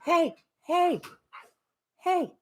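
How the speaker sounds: high, soft coaxing to the dog; soft high voice calming a puppy; soft puppy talk. Real, not acted. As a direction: soothe the puppy gently.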